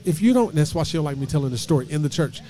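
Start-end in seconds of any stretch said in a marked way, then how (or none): 0.1-0.5 high, loud threatening start; a hammed-up angry big sister. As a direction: cut off a furious threat. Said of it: "They was like".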